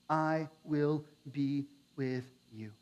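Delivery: slow, low and soft promise; calm the frightened man; gentle, steady divine reassurance; reassuring God. Acted. God's reassuring answer.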